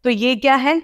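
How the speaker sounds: ordinary delivery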